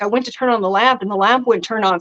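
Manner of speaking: normal talking voice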